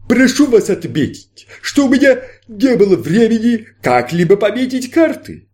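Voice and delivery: grand stage magician, inviting; showman magician. Acted. Magician patter.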